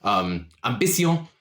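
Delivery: a hint of a smooth French charmer; French-accented orc. GM plays Le Chat.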